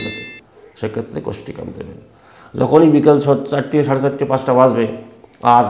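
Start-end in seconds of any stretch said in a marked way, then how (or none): 0.0-2.0 whisper. Real, not acted. Describing total voice loss.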